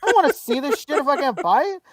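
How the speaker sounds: loud, high-pitched indignant rant; mock furious at the vendor; replaying the fight with the vendor, loud and high; retold angry outburst. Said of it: "I was so mad".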